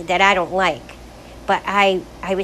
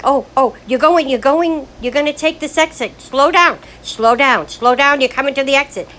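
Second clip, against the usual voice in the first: higher, louder urgent warnings; anxious backseat driver; 6 semitones higher, 6 dB louder than usual. Retold or imitated. Mom urging her daughter at the exit, anxiously loud. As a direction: keep nagging in a nervous rush. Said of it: "And I'm like".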